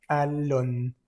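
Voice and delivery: Allons with no n sounded; nasal vowel. Shown as a demonstration. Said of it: "No pronunciamos esa N nunca".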